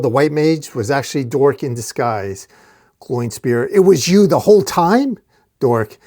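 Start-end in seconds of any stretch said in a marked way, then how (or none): 3.7-5.2 higher, louder incredulous question; astonished, voice jumping up; shocked realization. A narrator's reading. Glowing Spear discovering Dork in disguise, shocked and incredulous. Villain unmasking a disguised foe.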